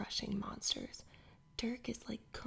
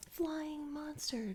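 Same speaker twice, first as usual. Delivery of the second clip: slow, higher, urgent whispered warning; whispered shout; 6 semitones higher, slower than usual. Acted. Dirk warning the kids of the beast, hushed mock shout. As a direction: sound the alarm without raising the voice much. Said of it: "Dirk shouts".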